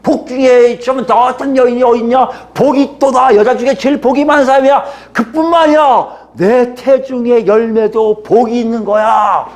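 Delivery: acted elated outburst of an older relative; Elizabeth's joyful cry. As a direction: exclaim the blessing in awe. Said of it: "엘리사벳을 통해서요".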